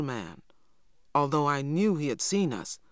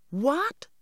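much higher, shocked "What?"; children exclaiming in shock, much higher; surprised exclamation; 11 semitones higher than usual. A narrator's reading. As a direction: blurt it out in disbelief. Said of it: "we exclaimed together".

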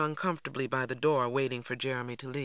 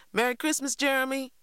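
called out much higher, ringing after him; cried out; 11 semitones higher, 3 dB louder than usual. A narrator's reading. Cassie calling after Jeremy as he leaves. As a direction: call after someone leaving the door. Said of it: "I cried after him".

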